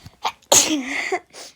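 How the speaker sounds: real sneeze mid-sentence; sudden loud sneeze; sneezing. Real, not acted. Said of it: "Hapşuracağım". (hapşırma)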